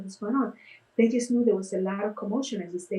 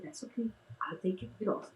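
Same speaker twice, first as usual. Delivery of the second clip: much softer, calm giving in; soft reassurance; 11 dB quieter than usual. Retold or imitated. Face painter calming the grandmother, soft reassuring. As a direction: soothe her gently.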